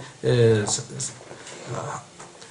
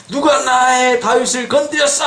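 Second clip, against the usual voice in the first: high, stretched-out indignant cry; outraged God; 6 semitones higher than usual. Acted. Preacher acting God avenging David, outraged. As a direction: demand it as an angry protector. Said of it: "하고 와서 다 갚아주셨더라".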